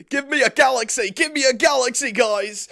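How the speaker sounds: high, loud whiny plea; imitated pleading shout; mock begging yell. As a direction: yell it like a desperate streamer begging. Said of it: "like, crying, yelling".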